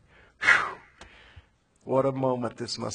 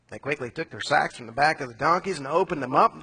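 usual speaking voice

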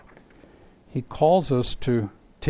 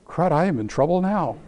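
mock guilty, laughing through it; laughing admission of guilt; 5 semitones higher, 6 dB louder than usual. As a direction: caught out and giggling. Sarah caught lying, laughing.

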